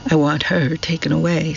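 plain reading voice

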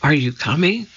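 ordinary delivery

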